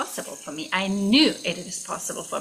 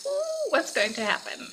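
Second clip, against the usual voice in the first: excited wonder; 9 semitones higher than usual. Retold or imitated.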